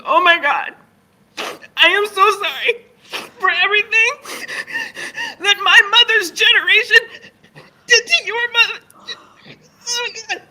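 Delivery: high, loud, teary overwrought wail; hammed-up crying gush of apology; mock sobbing apology. Woman in the bathroom line sobbing her apology to the speaker, high mock wail.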